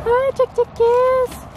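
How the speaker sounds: high cooing voice to chickens; calling chickens. Real, not acted. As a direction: coo up high to the hens.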